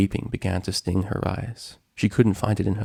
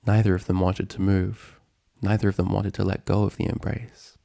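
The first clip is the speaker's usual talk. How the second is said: plain reading voice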